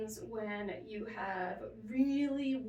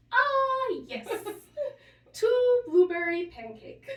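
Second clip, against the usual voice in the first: put-on high, loud, slow elderly-man voice; aphasia patient; 11 semitones higher, 11 dB louder, slower than usual. Nurse retells patient ordering breakfast, high slow mock old man. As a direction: draw it out sweetly, much higher and louder. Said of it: "She goes".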